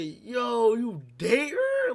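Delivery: loud, high acted disbelief, last word stretched; incredulous. Confronting stud about dating her, loudly incredulous. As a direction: call it out loudly, stretching the question.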